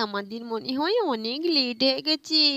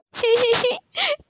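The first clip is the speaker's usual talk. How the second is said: high, loud giggling laugh; gleeful laugh; 11 semitones higher, 5 dB louder than usual. Acted. Sisters laugh at the punished little brother, high and gleeful. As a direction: cackle at the brother's trouble.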